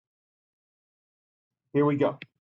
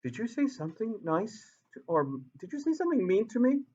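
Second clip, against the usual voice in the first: higher, softer innocent question; naive puzzled water; 5 semitones higher, 6 dB quieter than usual. Pretend. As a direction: sound sweetly confused, not bothered. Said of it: "Water's going".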